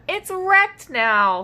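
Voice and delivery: dismayed. Acted. Upset dino.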